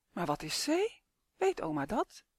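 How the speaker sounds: plain reading voice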